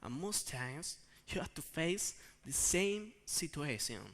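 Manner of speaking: ordinary talk, nothing marked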